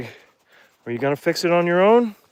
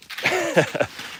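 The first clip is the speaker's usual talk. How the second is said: high nervous laugh; 6 dB quieter than usual. Real, not acted. Laughs on steep descent.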